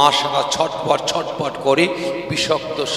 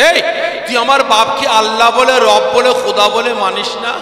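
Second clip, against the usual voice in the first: hot-headed demand; 7 semitones higher, 6 dB louder than usual. Acted. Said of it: "মাথা গরম হয়ে গেছে".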